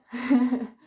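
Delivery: loud short chuckle; laugh. Real, not acted. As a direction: burst into a brief laugh. Laughs after own remark.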